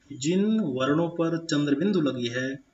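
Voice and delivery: usual speaking voice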